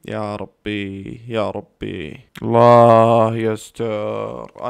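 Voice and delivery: very slow, stretched-out prayer; anxious drawn-out plea. Real, not acted. Fan praying before a penalty, drawn-out anxious plea. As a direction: drag out each word of the plea.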